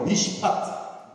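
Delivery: 미시파트 drawn out slow, syllable by syllable; 미시파트 said slow and whispered for repeating; repeat-after-me drill. Shown as a demonstration. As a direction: teach 미시파트 slowly for the crowd. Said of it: "이것도 따라해볼게요".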